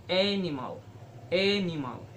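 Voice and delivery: "animal" stressed on the opening A; stress on first syllable. Shown as a demonstration. Teacher showing stress pattern one, "animal" strong on A. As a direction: hit the A of "animal", let the rest fall away. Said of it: "A is, A is stress".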